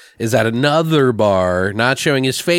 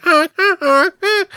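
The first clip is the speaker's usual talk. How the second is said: mock moaning; 3 dB louder than usual. Mocking moans in a movie scene.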